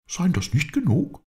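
very high-pitched indignant retort; Bob, indignant. A narrator's reading. Bob retorts to his master's question, high and indignant. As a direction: protest in a high character voice.

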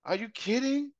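high, disbelieving are you kidding; a spoiled son's disbelief; high scoffing teen; incredulous son. Retold or imitated. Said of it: "And he was".

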